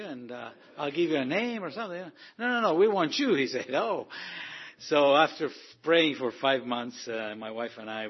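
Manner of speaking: ordinary delivery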